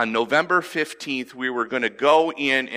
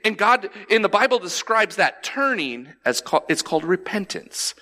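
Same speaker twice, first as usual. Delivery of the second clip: normal talking voice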